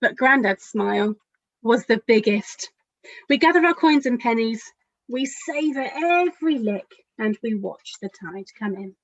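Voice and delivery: gentle picture-book storytelling; lively, caring storybook narration; reading to children. A narrator's reading. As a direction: read it as if to a room of small children. Author reads her picture book aloud.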